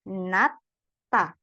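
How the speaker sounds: "nata" with a clear T; model reading. Shown as a demonstration. Teacher showing the student how to read it, clear "nata". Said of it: "jadi bacanya seperti apa".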